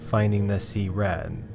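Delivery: ordinary delivery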